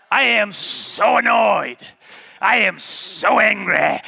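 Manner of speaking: hammed-up whiny complaint; mock grumbling. Mocking people who complain.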